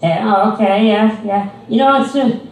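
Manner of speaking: put-on high, loud condescending old lady; mocking a patronizing old lady at the mall. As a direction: talk down to him like a child, sugary and loud. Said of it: "old lady voice continues".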